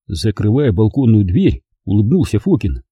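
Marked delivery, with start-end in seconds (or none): none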